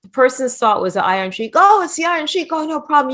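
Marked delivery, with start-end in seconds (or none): 1.5-3.1 high-pitched starstruck exclamation; excited recognition. Retold or imitated. Stranger finding the Iron Sheik in the garage, excited mock exclamation. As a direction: imitate an awed stranger, pitched well up.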